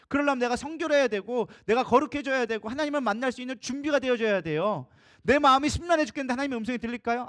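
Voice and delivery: high-pitched, insistent sermon delivery; pressing pulpit exhortation; fervent preaching. Real, not acted. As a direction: exhort the congregation with rising urgency. Preaches an exhortation with rising force.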